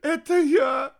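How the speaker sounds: high, loud tearful confession; sobbing. A narrator's reading. Heir confessing to his mother, sobbing. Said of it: "сквозь рыдание произнёс наследник".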